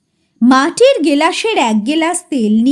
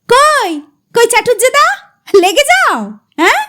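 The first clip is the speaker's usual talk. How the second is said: loud voice; 7 semitones higher, 3 dB louder than usual. Acted. Narrator hailing gloomy Chatujje-da, loud call. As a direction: call out high and loud to him. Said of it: "জোর গলায় বলি".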